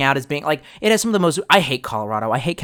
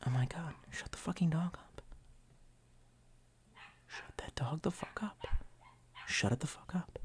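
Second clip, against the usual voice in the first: hissed curses under the breath; angry whisper; 20 dB quieter than usual. Real, not acted. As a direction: whisper through clenched teeth at a yappy dog. Cursing the barking dog.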